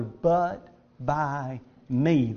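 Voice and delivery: "but by me" slowed, each word set apart; low, deliberate "but by me", word by word; emphatic BY ME. Acted. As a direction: pause between "but", "by" and "me". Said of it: "There's the emphatic".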